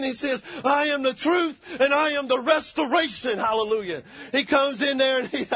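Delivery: fired-up pulpit preaching. Real, not acted. Preacher speaking truth and restoration into families, preaching. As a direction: exhort the church, punching the key words.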